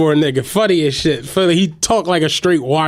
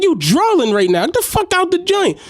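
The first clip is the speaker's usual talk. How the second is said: high sharp order; mock yell; 9 semitones higher than usual. Driver ordering kid off the bus, high mock yell. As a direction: snap it at the kid.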